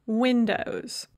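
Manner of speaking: ordinary talk, nothing marked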